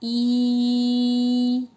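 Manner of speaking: ई held long and steady, teeth pressed; long ई sound. Shown as a demonstration. Teacher models the vowel ई for the class. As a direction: draw out a long ई through the teeth. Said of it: "सभी बच्चे मिलके बोलेंगे".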